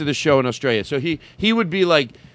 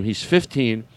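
ordinary delivery

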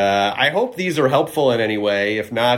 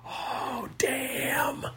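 awed whisper; 11 dB quieter than usual. Real, not acted. Reacting to a heavy quote.

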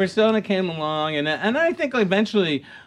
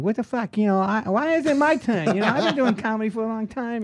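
higher-pitched mock indignation; resentful comics; 6 semitones higher than usual. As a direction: imitate a comic griping in protest. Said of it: "there was a lot of anger in Boston".

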